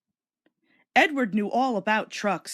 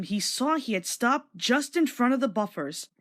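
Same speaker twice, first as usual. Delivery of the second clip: plain reading voice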